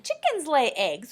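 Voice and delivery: usual speaking voice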